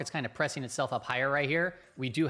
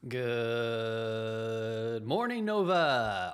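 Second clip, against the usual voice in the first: very slow, long-drawn good morning; stretched greeting; 3 semitones lower, much slower than usual. Acted. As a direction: pull the word out like a lazy wake-up call.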